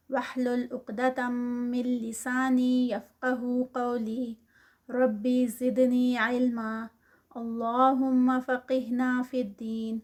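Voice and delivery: slow, measured recitation of a dua; prayerful recitation. Acted. Teacher opens the lesson with a prayer, slow recitation. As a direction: recite the opening prayer slowly and devoutly.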